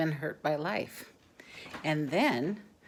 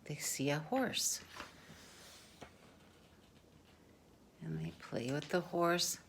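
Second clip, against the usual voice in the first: soft whisper; 9 dB quieter than usual. Real, not acted. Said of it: "whispered narration beside film".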